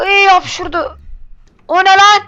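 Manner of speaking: high loud startled cries; shocked, shouting what's that. Real, not acted. Startled by sneezing monster.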